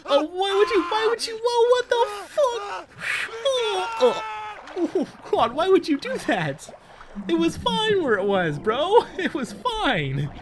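very high, loud squealing dismay; shrieking dismay. Real, not acted. Gamer shrieking as something creepy happens on screen. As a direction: squeal in horrified disbelief. Freaked out by game event.

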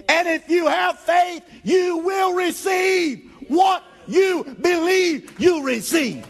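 high, loud, shouting preacher; full-force preaching. Acted. Pastor driving home faith to the church, full-force preaching. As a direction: preach it hard to the congregation.

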